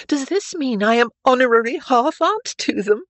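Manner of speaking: put-on high, loud girlish question; delighted Stephanie. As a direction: ask it with bright, amused astonishment.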